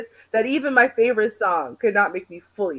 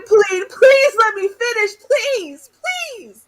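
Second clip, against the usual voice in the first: high, slow, louder pleading; mock begging; 12 semitones higher, 7 dB louder, slower than usual. Guest joking she'll beg the universe to let her finish, high mock plea. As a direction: plead with the universe like a desperate lover. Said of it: "I'm gonna beg him".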